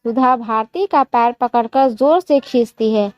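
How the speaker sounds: plain reading voice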